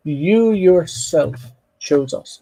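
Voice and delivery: YOU YOURSELF slow and weighty, then dropping; stress on YOURSELF. Shown as a demonstration. Teacher translating the emphatic pronoun, stress on YOU YOURSELF. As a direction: draw out YOU YOURSELF, ease off after. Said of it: "It's emphatic".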